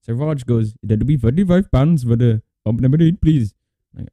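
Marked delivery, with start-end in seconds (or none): 0.8-3.5 a light mock Indian accent, a touch louder; imitated Indian accent. Host mimics petrol station cashier Raj in a mild Indian accent. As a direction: put on the cashier's Indian accent, politely insistent. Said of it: "just do the accent".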